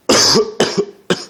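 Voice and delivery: a dry unvoiced cough; real cough. Real, not acted. Vlogger coughing mid-story, real cough. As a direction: clear a tickly throat with a cough.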